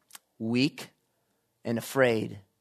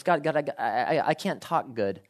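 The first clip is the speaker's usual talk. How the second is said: lightly stuttering, halting start; a hint of a stammering Moses. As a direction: trip over the opening words like a nervous stammerer. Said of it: "some sort of speech impediment".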